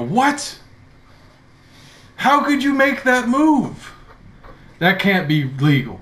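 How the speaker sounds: high, slow indignant cry; a human man protesting a board game move; outraged gamer. Acted. Dockside player crying foul, high and indignant. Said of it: "you hear the human man go".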